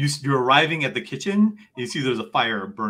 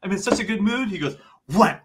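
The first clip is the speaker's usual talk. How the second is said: much higher, louder cheerful voice; jolly shopkeeper; 8 semitones higher, 5 dB louder, faster than usual. Acted. Mister Pamplona handing over his pants, high and cheerful. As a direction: bubble over with good mood. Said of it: "he's just like".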